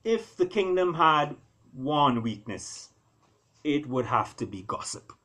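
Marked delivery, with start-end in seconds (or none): none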